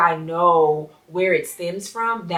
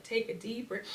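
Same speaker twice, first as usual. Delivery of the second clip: soft child imitation; 3 semitones higher, 13 dB quieter than usual. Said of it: "She'll be like".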